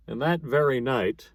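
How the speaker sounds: plain reading voice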